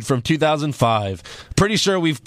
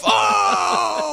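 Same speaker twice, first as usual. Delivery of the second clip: disgusted oh. Retold or imitated. Disgust at the smell.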